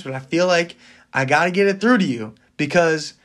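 usual speaking voice

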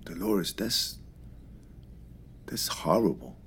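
soft, stunned whisper; hushed shock. Acted. Friend hearing of her dad's death, whispered shock. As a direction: barely breathe it out in disbelief.

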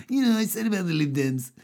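a hint of bouncy comedian; lightly voiced stand-up patter; Michael McIntyre impression. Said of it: "if Michael McIntyre suddenly started pivoting".

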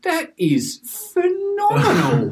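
high, slow gasp of awe; amazed, drawn-out marvel; awestruck. Retold or imitated. Jaws dropped at the shot.

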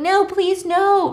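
high mock scream, only moderately loud; retold screaming. Claire screaming at Virgil to stay out, mock pleading scream. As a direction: imitate a pleading scream without full force. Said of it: "she's just screaming".

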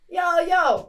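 high, loud mock Brooklyn call; brash Brooklyn caricature, all volume; shouted Brooklyn accent. Shown as a demonstration. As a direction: holler like a bad American impression. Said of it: "All I'm doing is shouting".

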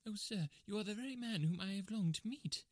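high, excited little-man voice; high-pitched, fervent outcry; crying out eagerly. Acted. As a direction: gush with nervous eagerness. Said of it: "cried the little fellow".

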